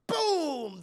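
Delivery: drawn-out, high-starting boom; booming storyteller sound effect; imitated explosion. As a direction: make a big blast with your voice.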